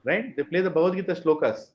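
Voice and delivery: usual speaking voice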